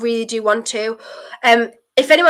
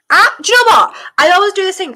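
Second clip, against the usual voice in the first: loud high excited outburst; 9 semitones higher, 6 dB louder than usual. Real, not acted. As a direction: burst out excitedly. Excited remembering.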